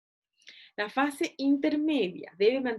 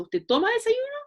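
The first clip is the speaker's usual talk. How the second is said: high, bright questioning interviewer voice; interviewer asking; 12 semitones higher, 4 dB louder than usual. Shown as a demonstration. Nutritionist role-playing the interviewer to students, high and questioning. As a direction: ask it up high like a friendly clinician.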